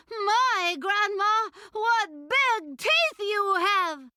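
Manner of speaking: put-on high, drawn-out girl's voice; storybook little girl, high and slow. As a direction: marvel slowly, pitched well up.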